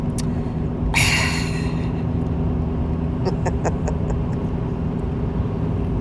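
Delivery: long weary exasperated sigh. Retold or imitated. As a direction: heave out a long tired sigh. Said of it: "she'll go".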